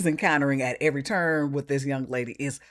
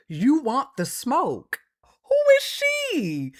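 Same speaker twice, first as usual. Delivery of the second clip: mock tough challenge; 10 semitones higher, 4 dB louder, slower than usual. Host mocks confronting threat.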